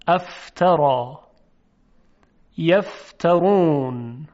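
ف said slow with air pushed between teeth and lip; slow fa demos. Shown as a demonstration. Teacher demonstrating ف to students, slow and breathy. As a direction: model ف slowly.

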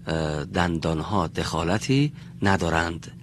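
ordinary talk, nothing marked

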